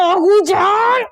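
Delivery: drawn-out call. Acted. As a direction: stretch the name out slowly and call it loudly. Calls out after grandmother. (धीमी, खिंची हुई ऊँची पुकार)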